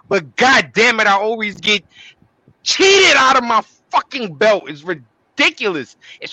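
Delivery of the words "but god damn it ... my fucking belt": angry rant. Real, not acted. Ranting about being cheated.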